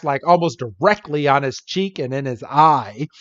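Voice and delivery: ordinary delivery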